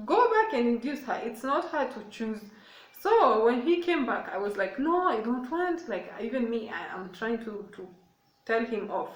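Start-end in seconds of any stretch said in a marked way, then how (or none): 0.1-2.4 commanding. Retold or imitated. Mom ordering doctor. 4.8-5.9 high, loud, slow no; loud refusal. Retold or imitated. Mother in labor loudly refusing the young doctor, high slow no.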